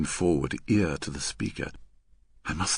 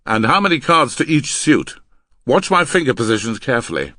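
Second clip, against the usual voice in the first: loud, raised drilling question; demanding; 5 semitones higher, 9 dB louder than usual. Acted. Man testing the girl on signals, demanding. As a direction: quiz the partner forcefully.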